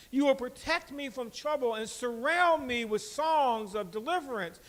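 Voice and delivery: loud, declaiming psalm reading from the pulpit; scripture reading. Acted. Pastor reading Psalm to congregation, loud declaiming. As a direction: proclaim the verses to the church.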